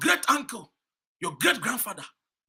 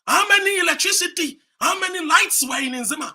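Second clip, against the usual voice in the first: higher and louder, a furious rhetorical question; indignant shout; 9 semitones higher, 6 dB louder than usual. Real, not acted. Speaker demanding of the Nzima crowd where their lights were, shouted.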